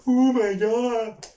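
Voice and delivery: high, loud drawn-out "oh my god"; mock fan cheer. Mocking Celtic fans celebrating a goal, loud high mock excitement. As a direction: whoop it like an overexcited fan. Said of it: "and they're like".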